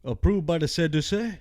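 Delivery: mildly voiced Cajun friend; Boudreaux voice. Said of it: "Boudreaux said".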